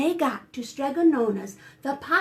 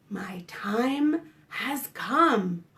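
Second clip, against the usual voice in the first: slow scheming whisper; sly whisper; 3 semitones lower, much slower than usual. Acted. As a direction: whisper the plan, slowly. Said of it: "inside he was thinking".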